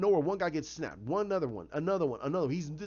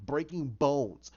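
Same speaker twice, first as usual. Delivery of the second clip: ordinary talk, nothing marked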